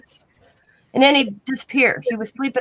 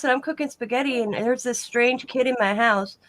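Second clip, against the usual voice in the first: usual speaking voice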